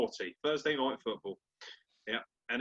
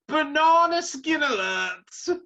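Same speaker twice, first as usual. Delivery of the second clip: even higher, slow, loud siren-like call; hammed-up alarm at its peak; squealing the warning way up high; mock alarm, higher; 14 semitones higher, 7 dB louder, much slower than usual.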